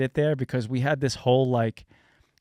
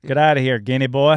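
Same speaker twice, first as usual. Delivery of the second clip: louder, hammed-up dismissal; mock-hostile send-off; mock bigot; 8 dB louder than usual. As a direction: playfully chase them off in character. Acts out Southerners rejecting Italians.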